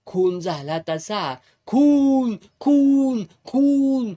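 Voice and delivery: high shrieking cries of खून; screaming murder. A narrator's reading. Villager screaming the alarm of murder. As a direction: wail the alarm at full voice. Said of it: "असं बोंबलत".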